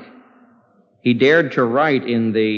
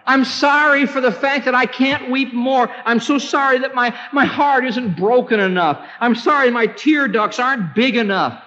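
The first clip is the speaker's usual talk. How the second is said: very high, pleading lament; acted penitent crying out to God; Jeremiah's anguished prayer; 12 semitones higher than usual. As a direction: beg God with high, sustained urgency. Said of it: "He was saying".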